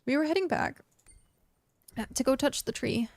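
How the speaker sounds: talking through hiccups. Real, not acted. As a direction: speak between hiccups.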